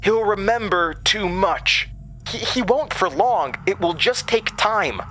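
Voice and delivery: high, pleading argument; Jasmine yelling. A narrator's reading. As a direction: push back hard, voice pitched high. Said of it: "Jasmine was yelling at Director Summers".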